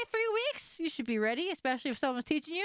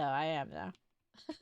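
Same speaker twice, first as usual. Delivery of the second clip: usual speaking voice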